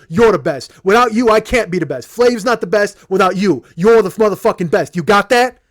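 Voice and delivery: fervent, very loud high holler. Retold or imitated. Rapper hugging fan as hard as he can, fervent holler. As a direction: shout it like a wild-eyed hype man. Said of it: "He goes".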